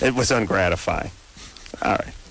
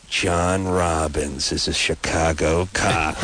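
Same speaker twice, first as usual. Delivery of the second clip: mock movie line; 6 semitones lower, slower than usual. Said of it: "they do these things like".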